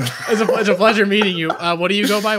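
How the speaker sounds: loud, laughing interruption. Real, not acted. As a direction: cut in through your laughter. Laughing while cutting off the rambler.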